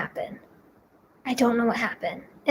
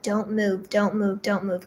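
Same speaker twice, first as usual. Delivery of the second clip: soft repeated murmur to herself; hushed self-talk; 3 semitones lower, 6 dB quieter than usual. Retold or imitated. Patient under the needle tells herself, hushed. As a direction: quietly coach yourself to keep still. Telling herself to stay still.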